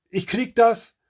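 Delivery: high-pitched, pressed protest; strained insistence. A narrator's reading. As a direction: insist you can manage despite the cough. Gangster insists as coughing worsens.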